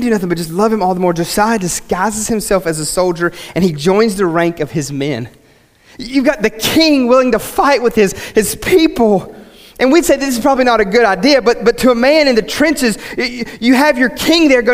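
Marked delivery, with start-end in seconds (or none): 6.0-14.7 higher, impassioned preacher voice; fervent preaching. Acted. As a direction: preach it with rising fervour. Preacher builds to full force.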